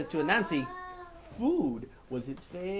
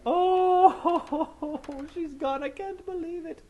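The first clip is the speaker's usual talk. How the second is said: high wailing mourners; 13 semitones higher, 7 dB louder, much slower than usual. Acted. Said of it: "sitting around crying".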